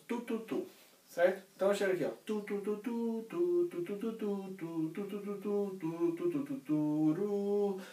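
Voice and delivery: sung tu syllables, last one softened to ru; wind articulation syllables. Shown as a demonstration. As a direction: sing the tonguing like a wind player. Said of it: "O instrumento de sopro, nós fazemos tu".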